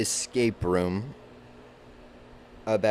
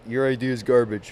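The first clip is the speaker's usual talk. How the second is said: a mock-blunt, louder put-down voice; 6 dB louder than usual. Reviewer imagining someone trashing a passion project, mock harsh. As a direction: toss it off as a lightly voiced harsh critic. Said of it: "was like".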